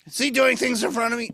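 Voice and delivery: usual speaking voice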